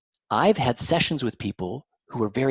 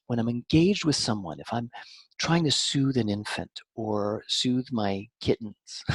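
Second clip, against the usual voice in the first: normal talking voice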